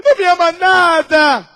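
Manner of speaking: hammed-up nagging wife voice; whiny wife. Pastor voicing a wife's complaint. (esposa chorona, debochada e mais alta)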